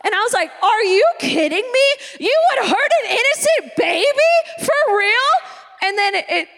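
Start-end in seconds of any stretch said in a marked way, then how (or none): 0.6-5.3 high, swooping tearful disbelief; mock outrage. Younger self appalled that baby Jesus was hurt, mock outrage. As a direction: protest in hammed-up heartbroken indignation.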